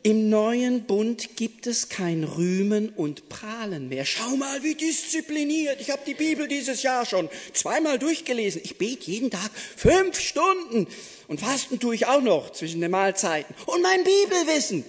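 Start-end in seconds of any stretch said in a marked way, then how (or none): 4.0-14.8 boastful pious braggart. Pretend. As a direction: brag about your piety, fast and proud. Mocking boastful self-righteous believer.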